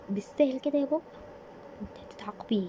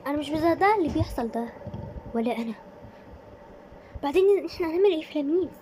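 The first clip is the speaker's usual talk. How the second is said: higher, louder, faster panicked chatter; panicked disbelief; 6 semitones higher, 4 dB louder, faster than usual. Acted. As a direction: rush the words in shock. Friends panic afterwards.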